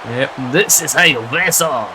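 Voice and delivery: a lightly put-on, hammy French accent; a hint of a mock French commentator. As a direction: slip in a bad French accent on purpose. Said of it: "That's a horrible French accent attempt".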